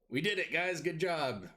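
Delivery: pleased with himself; Grak cheering. Acted. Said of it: "he's like".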